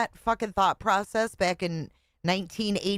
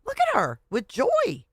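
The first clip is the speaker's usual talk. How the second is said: appalled disbelief; 8 semitones higher than usual. Real, not acted. Appalled at smiling in clip.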